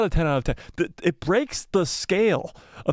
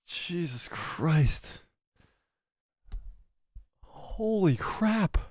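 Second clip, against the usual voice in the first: slow, soft, awestruck; drawn-out hushed amazement; stunned awe; 6 dB quieter, much slower than usual. Real, not acted. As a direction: let it out slowly, floored by it. Awed by what he just watched.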